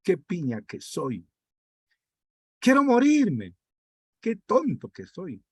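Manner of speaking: sudden high anguished cry on quiero morirme; mock self-loathing lament; despairing self-talk. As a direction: act out a self-pitying despair. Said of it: "nos autoeliminaremos cuando hablamos".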